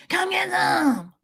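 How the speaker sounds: high, drawn-out eerie mock threat; scary threat. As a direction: wail it slowly to creep him out. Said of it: "wanted to scare him".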